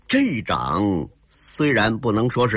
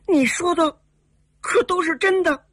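high, tense demand; shocked heroine. A narrator's reading. Heroine confronts the stranger after the revelation, shocked. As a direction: press for the truth, pitched right up.

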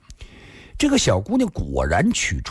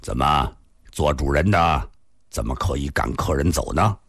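low, mild teasing man; lightly amused low male voice; low male character; 9 semitones lower than usual. A narrator's reading. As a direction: question her in an easy low voice. Man teasingly questions his host.

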